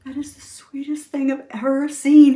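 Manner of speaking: high, loud, touched exclamation; retold tearful admiration; imitating choked-up husband. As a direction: gush as if moved, lightly mock choked-up. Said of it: "really got choked up".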